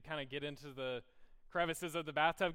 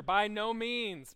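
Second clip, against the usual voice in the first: By no means said very slowly, louder and higher, every word hit; emphatic answer; 6 semitones higher, 8 dB louder, much slower than usual. Retold or imitated. Pastor giving Paul's answer, slow and emphatic. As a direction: drag out By no means with force. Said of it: "this emphatic answer".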